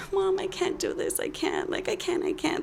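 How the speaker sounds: high, breathy and breaking into near-whisper; imitated tearful plea. Student calling mom from outside class, acted tearful plea. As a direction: act out a helpless, tearful plea. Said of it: "I just felt like crying".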